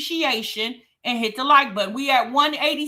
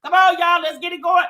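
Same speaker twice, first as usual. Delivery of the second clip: ordinary talk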